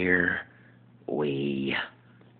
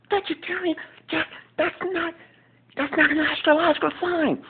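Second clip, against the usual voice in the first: much higher, louder exasperated sitcom voice; Will impression; 4 dB louder than usual. Will scolding Jack over his newspaper, high and loud. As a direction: scold a friend who interrupts your paper. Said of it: "my impersonation of Will".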